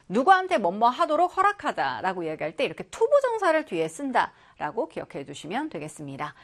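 normal talking voice

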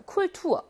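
쿨투어 with the second syllable stressed. Shown as a demonstration. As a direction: lean on 투 in 쿨투어. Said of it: "이게 그래서 이음절 강세어죠". (쿨투어의 둘째 음절 '투'에 강세)

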